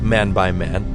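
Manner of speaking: usual speaking voice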